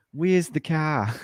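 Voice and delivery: slow mock Australian accent. Shown as a demonstration. Showing friends how Australians say it, slow Australian accent. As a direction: draw it out slowly like an Australian. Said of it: "the Australians are like".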